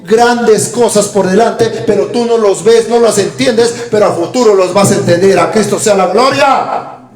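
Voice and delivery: slow, loud, climbing preaching; fervent preaching. Acted. Preacher exhorts the congregation, slow, loud and climbing. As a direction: drive it home from the pulpit.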